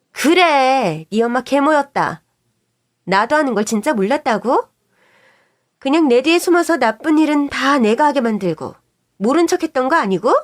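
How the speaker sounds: loud, bitter, accusing; angry shouting. Acted. Wife yelling the secret at her husband, furious. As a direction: throw the truth at him furiously.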